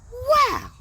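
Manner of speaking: high, loud burst of wow; excited wow. Shown as a demonstration. Teacher shows the class how to read it, an amazed wow. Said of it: "So that's how you'd read it".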